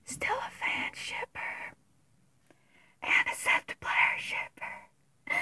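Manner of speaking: secret unvoiced whisper. Real, not acted. Admitting to viewers she still ships them, hushed whisper. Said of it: "whispered confession".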